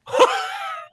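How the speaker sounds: high-pitched cackle of laughter; helpless high laugh; burst of laughter. Real, not acted. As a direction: crack up in a high squeal. Cracks up after her impression.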